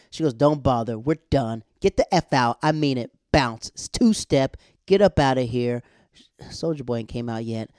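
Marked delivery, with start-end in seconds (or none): 0.3-5.8 loud, punchy breakup tirade; acted dismissal. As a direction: throw him out, each short order hit hard. Acting out kicking boyfriend out.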